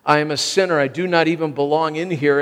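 usual speaking voice

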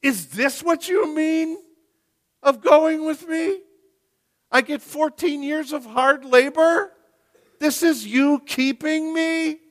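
high, loud, incredulous complaint; bitter questioning. Acted. Preacher voicing Jacob questioning God's promise, high incredulous complaint. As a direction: throw each question up at God in disbelief.

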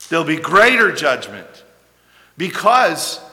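preacher thundering a warning about judgment; forceful preaching. Acted. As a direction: drive the warning home from the pulpit. Preacher rising to full force.

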